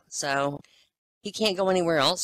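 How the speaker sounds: ordinary talk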